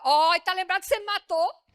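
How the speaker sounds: son's mock accusation, loud and playful; cheeky child retort. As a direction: throw it back like a sly kid. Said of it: "Eu já te dei a mesada".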